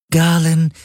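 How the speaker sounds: high, level synthetic call of a name; Shadow Helix. Acted. Shadow Helix addresses its owner, high level voice.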